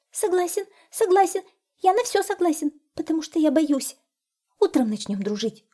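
high, hurried agreeing little hare; timid hare rushing to say yes; scared hare. A narrator's reading. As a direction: agree to anything out of fear.

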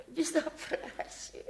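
slow breathless half-laughing whisper. Acted. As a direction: gasp it out, winded from the fall. Repeats the word after falling.

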